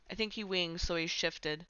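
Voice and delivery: ordinary talk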